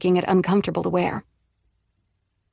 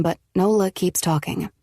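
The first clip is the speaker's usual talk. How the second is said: plain reading voice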